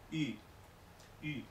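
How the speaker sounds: I said with u lips and i tongue; ü vowel. Shown as a demonstration. Teacher repeats ü after explaining lips and tongue. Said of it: "La lengua de una I, los labios de una U".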